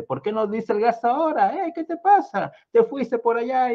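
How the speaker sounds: high, scolding complaint; quarreling wife. A narrator's reading. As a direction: nag the husband sharply.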